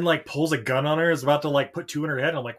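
normal talking voice

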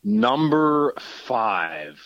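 stretched very slowly while thinking; drawn-out stalling. Shown as a demonstration. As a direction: drag the words out to buy time.

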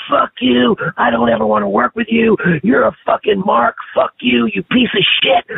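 high, heated cursing tirade, imitated; imitating a furious screaming rant; angry promo rant. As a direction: mimic a furious wrestler tearing into a rival. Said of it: "started cutting promos".

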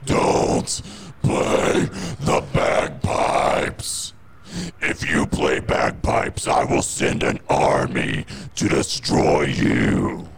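deep demonic threat. Acted. Satan threatening.

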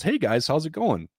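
high, breezy imitated greeting; casual phone greeting. President answering the rescued rowers' call, casually cheery.

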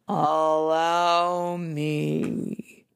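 very slow, calm plow horse. Acted. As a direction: drawl it out like a big horse. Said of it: "said a plow horse".

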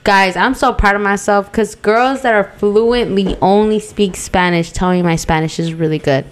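ordinary talk, nothing marked

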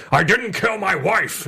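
slow Harrison Ford line read; Harrison Ford impression. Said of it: "I always go".

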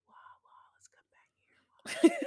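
slow hushed whisper to a sleepwalker; whispered coaxing; soothing whisper. Pretend. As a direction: coax them back in a whisper.

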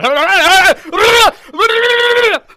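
huge high burst of laughter; loud shrieking laugh. Real, not acted. Hosts cracking up after the Wisconsin bit, loud high laugh. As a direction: crack up loudly.

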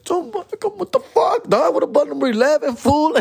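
very high mock squeak, outraged; indignant kid. Young [name] protesting being ignored, very high mock squeak. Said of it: "I'll be like".

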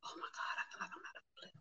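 breathless frustrated whisper under her breath. Real, not acted. Host struggling with livestream tech, whispering in frustration. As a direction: whisper it, about to lose it.

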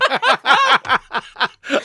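real loud, high cackle; high-pitched cackle. Real, not acted. Friend cracking up at own cheeky joke, high-pitched cackle. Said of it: "when you do that high-pitched laugh".

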